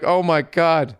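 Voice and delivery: high-pitched mock alarm; retold scare. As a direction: replay being freaked out, half-joking. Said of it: "I got all fucking scared".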